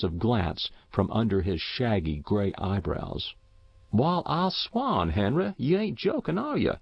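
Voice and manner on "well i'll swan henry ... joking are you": astonished farmer. A narrator's reading. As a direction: play a country farmer who can't believe his ears. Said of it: "said the solid Dodge".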